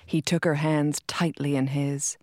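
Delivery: ordinary delivery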